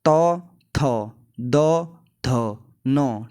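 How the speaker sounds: तौ, थ, द, ध, नौ said slowly and deliberately; slow it down, letter by letter; slow dental recitation. Shown as a demonstration. Teacher slowly repeating the dental letters. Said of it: "देखो".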